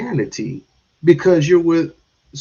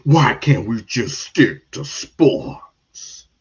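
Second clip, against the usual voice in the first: mocking RG3; 5 semitones lower, much slower than usual. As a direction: drawl it like a sulky complaint. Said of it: "he got the nerve to tell Stephen A".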